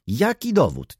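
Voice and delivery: very high-pitched, insistent question; challenging cry. A narrator's reading. Baroness demands the seller's proof, calling out. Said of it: "zawołała baronowa".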